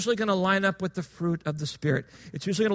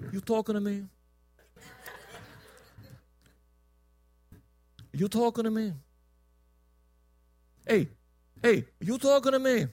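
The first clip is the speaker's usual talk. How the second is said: Robert De Niro; 4 dB quieter than usual. Acted. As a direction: challenge them in a put-on New York voice. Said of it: "Let me do my little De Niro impression".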